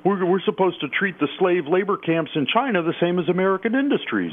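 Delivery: high, whiny panicked libertarian; mock the pearl-clutching libertarian. Mocking a Cato libertarian freaking out, high and whiny. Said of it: "It's like".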